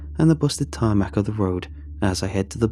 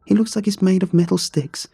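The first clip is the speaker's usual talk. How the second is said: higher voice, mild exasperation; exasperated friend; 7 semitones higher than usual. Acted. Friend explaining the spindly man to Alex, mildly exasperated. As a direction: explain it with a touch of impatience.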